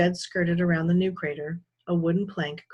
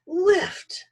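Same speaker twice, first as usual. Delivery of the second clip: high, loud yelled Lift; straining with effort, yelling Lift to himself; 14 semitones higher, 5 dB louder than usual. A narrator's reading. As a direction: yell Lift at full strain. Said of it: "Jed yelled to himself".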